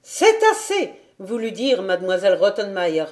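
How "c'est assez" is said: high, loud outraged cry; choked indignation. A narrator's reading. Governess tries to stop the meowing, indignant cry. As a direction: cut it off with high-pitched outrage. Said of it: "l'indignation lui coupa la voix".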